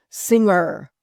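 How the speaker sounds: a touch of a drawn-out, overly precise TV host; over-enunciating host. Retold or imitated. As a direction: lightly mimic the host stretching the show name. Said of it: "he makes sure that he says everything".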